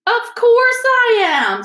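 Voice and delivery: high, loud, drawn-out outburst; emphatic insistence. Acted. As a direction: exclaim it as utterly obvious. Ron insists on his suspicion.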